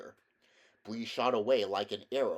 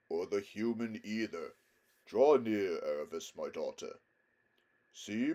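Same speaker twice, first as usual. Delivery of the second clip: majestic lion, tender; 3 dB louder than usual. A narrator's reading. Aslan reassures horse and girl.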